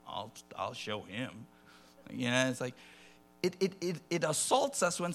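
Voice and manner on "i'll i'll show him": lower, softer, slow resentful mutter; grumbling. Retold or imitated. Traveller taking off shoes, low slow resentful mutter. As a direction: mutter a petty threat under your breath. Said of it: "taking your shoes off".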